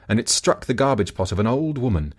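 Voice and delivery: usual speaking voice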